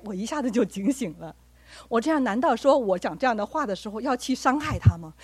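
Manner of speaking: normal talking voice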